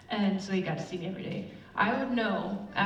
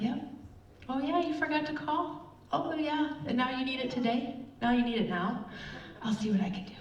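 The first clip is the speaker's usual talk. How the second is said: high, mock-sweet sarcasm on the phone; sweetly sarcastic, biting back irritation; fake-polite annoyed customer service voice; sarcastic annoyance; 5 semitones higher than usual. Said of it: "Of course I'm angry".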